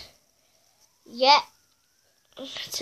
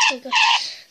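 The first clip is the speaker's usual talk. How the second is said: surprised squeal; 6 dB louder than usual. Real, not acted. Surprised by ads popping up.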